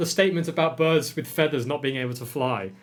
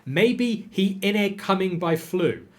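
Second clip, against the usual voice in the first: lightly Scottish, slow, pitched up; Scottish spelling read out; 6 semitones higher, slower than usual. Acted. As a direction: read the Scots words as written. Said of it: "pronounce it the way it is written".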